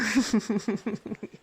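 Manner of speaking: bashful airy laugh; embarrassed giggle. Acted. Nurse caught blushing by patient, bashful giggle. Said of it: "yes, I'm blushing".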